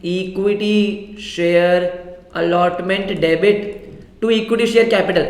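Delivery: each word of the entry drawn out slowly; slow dictation. Shown as a demonstration. Teacher to class, dictating the entry word by word slowly. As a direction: dictate the journal entry slowly so students can write it. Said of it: "यही entry होती है".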